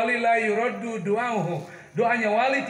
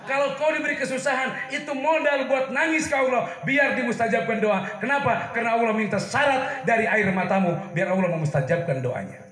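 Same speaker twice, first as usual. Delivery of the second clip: raised, driving sermon voice; impassioned preaching; 4 semitones higher than usual. Real, not acted. Preacher urging congregation to weep to Allah, impassioned exhortation. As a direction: preach it with emphatic urgency.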